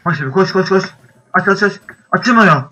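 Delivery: panicked, high and urgent; frantic escape, shouting at door; being chased. Real, not acted. Fleeing, door won't open.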